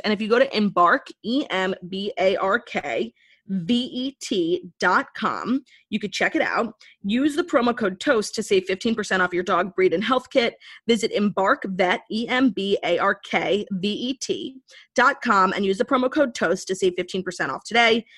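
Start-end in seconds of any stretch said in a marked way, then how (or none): 1.2-5.6 spelled out slowly. Acted. 12.1-15.5 spelled out slowly. Acted.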